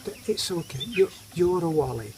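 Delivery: soft, half-whispered comfort; tender teasing. Retold or imitated. Wife stroking husband, softly teasing him. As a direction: soothe him like a child, gently teasing. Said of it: "she'll stroke me and say".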